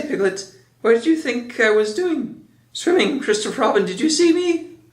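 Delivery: high-pitched squeaky little creature; breathlessly eager show-off, pitch leaping up; excited Roo. A narrator's reading. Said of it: "squeaked Roo excitedly".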